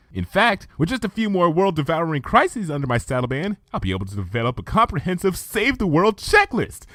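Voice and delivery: Twilight's chipper voice. Acted. Twilight assuring Luna she likes saving the world, chipper. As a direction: rattle off the plan with pride.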